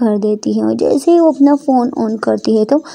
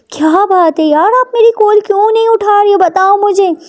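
higher-pitched, insistent questioning; demanding Ishani; 8 semitones higher than usual. Acted. Ishani demands why Prita ignores her call, demanding. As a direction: press for an answer, voice raised in pitch.

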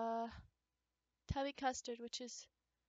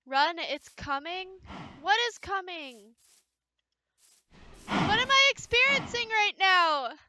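scared confusion; 3 semitones higher, 7 dB louder than usual. Real, not acted.

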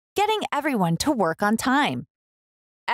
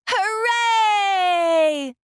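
much higher, loud cheer; cheering hooray; 11 semitones higher, 3 dB louder than usual. Acted. Townspeople cheering the new bridge, joyful. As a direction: celebrate with the whole town. Crowd celebrates opening.

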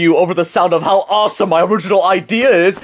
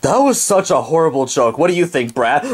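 ordinary talk

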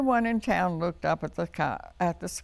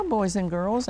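plain reading voice

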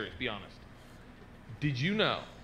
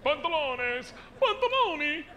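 higher-pitched mock Spanish, then Italian; Spanish and Italian accents; 9 semitones higher than usual. Comic comparing two languages for the crowd, mock Spanish and Italian. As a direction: ham up Spanish and Italian words. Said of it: "like Italian and Spanish".

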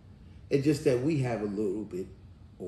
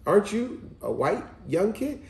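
lightly voiced mother, higher pitch; higher-pitched quoted question; mother pressing; 6 semitones higher than usual. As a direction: imitate a mom pressing her son. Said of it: "She said".

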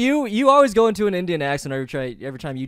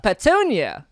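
louder, higher Mulaney "Petunia"; John Mulaney impression; 6 semitones higher, 6 dB louder, much slower than usual. Copying the Mulaney line, louder and higher. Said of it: "one line I can do of John Mulaney".

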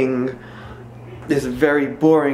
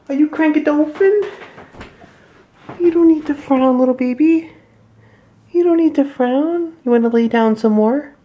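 higher, louder cooing; 10 semitones higher, 4 dB louder than usual. Real, not acted. Cooing at pouting baby.

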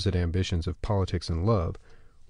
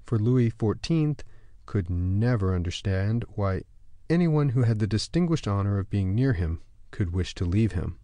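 usual speaking voice

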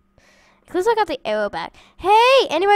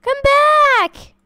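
calling out; 7 semitones higher, 4 dB louder than usual. Real, not acted. Chasing villager to return flowers.

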